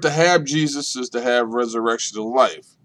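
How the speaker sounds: ordinary talk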